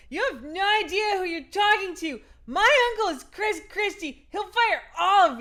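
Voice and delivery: put-on high, loud drunken shouting; drunk yelling in caps. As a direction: yell it like an entitled drunk niece. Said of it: "reenact this as though I'm drunk and in all caps".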